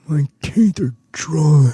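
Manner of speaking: slow, odd put-on Edgar voice; Edgar character voice. Comedian doing his Edgar character, slow and odd. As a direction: drag out the words as Edgar. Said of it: "Edgar's, uh".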